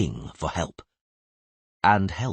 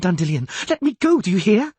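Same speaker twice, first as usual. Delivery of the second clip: high, loud shrieking plea; hysterical scream; 6 dB louder than usual. Acted. Young medical student screaming to be let go, hysterical. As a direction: shriek to get free and help. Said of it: "was screaming hysterically".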